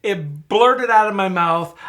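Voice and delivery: usual speaking voice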